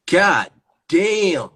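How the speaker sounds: loud, high, drawn-out amazement; shocked exclamation. Real, not acted. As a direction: cry it out in loud disbelief. Reacting to an ugly filter face.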